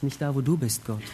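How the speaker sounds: soft, low, sober realisation; quiet inner thought. Acted. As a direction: think it aloud, hushed and low. Adams stiller Gedanke im Kopf. (leise und tief, nachdenklich)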